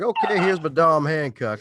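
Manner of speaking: loud, high mock voice; hammed-up autograph giver; mock signing. Host acting out signing the autograph, loud and high.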